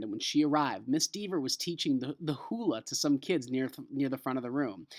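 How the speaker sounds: usual speaking voice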